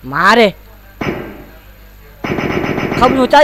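loud, high taunt; taunting call. Acted. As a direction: shout the challenge up high.

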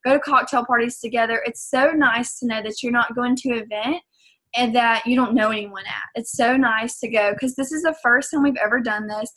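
usual speaking voice

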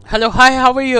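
ordinary delivery

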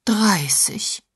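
lightly voiced horror at "Dreißig"; horrified. A narrator's reading. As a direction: repeat "Dreißig" in mild dismay. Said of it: "wiederholte Agatha entsetzt".

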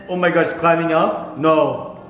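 ordinary delivery

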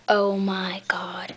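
slow breathless whisper. Real, not acted. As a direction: whisper it in awe. Whispered after the squeal.

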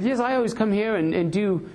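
a touch of the London bloke; friendly Brit bragging, mild English accent; British guy. Retold or imitated. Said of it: "and he said".

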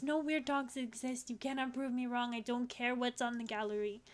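ordinary talk

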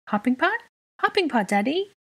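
put-on high, eager little-kid begging; high child voice, rising into a plea; pleading child. A narrator's reading. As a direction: plead upward like a hopeful small child. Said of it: "he pleaded".

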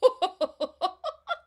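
very high, loud peal of laughter; real laughter. Real, not acted. Laughter coach laughing hard during smile ups. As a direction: laugh freely, ringing out. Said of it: "laughter, as you can tell, is always okay".

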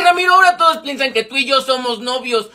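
ordinary talk, nothing marked

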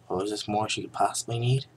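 plain reading voice

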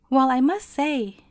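plain reading voice

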